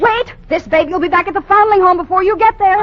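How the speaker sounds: put-on calling after him that the baby goes back; defiant call. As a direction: rush it out as a defiant threat.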